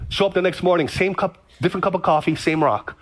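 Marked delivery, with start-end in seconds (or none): none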